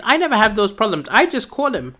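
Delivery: ordinary talk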